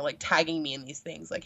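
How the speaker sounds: ordinary talk, nothing marked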